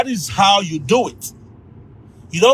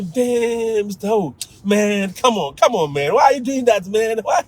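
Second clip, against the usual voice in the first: protesting student; 4 semitones higher than usual. Retold or imitated. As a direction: whine playfully at being outwitted by teacher. Retold student protesting being teased back.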